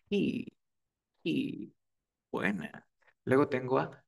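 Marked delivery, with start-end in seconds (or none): 0.1-1.7 "he" with a soft, airy H, no jota scrape; English H. Shown as a demonstration. Teacher models the pronoun "he" with a soft aspirated H. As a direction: breathe out the H of "he" lightly. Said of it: "Es como, entonces sería".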